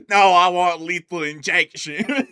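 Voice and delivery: loud, slow, hammed-up inmate; mock loud refusal. Inmate refuses the gas, asking for lethal injection, in a loud slow mock shout. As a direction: bellow it slowly like a joking death row prisoner.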